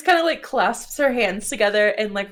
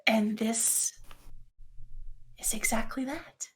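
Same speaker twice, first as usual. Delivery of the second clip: soft, slow, near-whispered recollection; hushed confiding; 9 dB quieter, slower than usual. Acted. Gris tells Raphael of the dream realm, hushed and slow. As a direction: confide it gently with long pauses.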